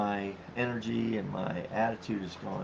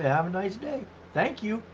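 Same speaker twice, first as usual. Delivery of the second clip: mock cheerful farewell; 9 semitones higher than usual. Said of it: "And we go say".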